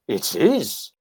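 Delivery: lightly imitated Welsh accent; Trevor Bray. Veteran confirms who he is, mimicked Welsh accent. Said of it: "he said in his Welsh accent".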